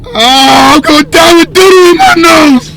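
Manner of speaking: high frantic wail of doom; panicked wail. Acted. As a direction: shriek it like you're about to die. Panicking as cop approaches.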